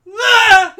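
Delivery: sudden loud, high yelp; mock scream. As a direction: cry out as if knocked down. Acts out movie victim falling down.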